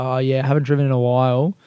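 fast, whiny excuse. Pretend. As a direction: rush a sheepish excuse. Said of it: "be a little bitch about".

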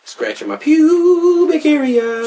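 high, slow goofy voice; silly high voice. Pretend. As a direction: stretch it out in a silly squeal.

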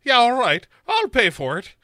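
high, loud mock woman's voice; imitating Sarah. Host voicing Sarah accepting a prison call, high mock voice. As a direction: pitch it up as the woman on the phone. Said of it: "And she's like".

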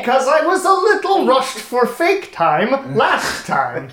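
put-on high, slow weird old man voice; drawn-out, creaky old geezer spelling; Democrito Zolezi's old voice. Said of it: "I have to get back into my weird old person voice".

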